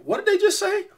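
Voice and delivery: high-pitched startled question; mock-incredulous squeak; doctor's startled look. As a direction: voice shooting up in disbelief. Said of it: "looked at me like".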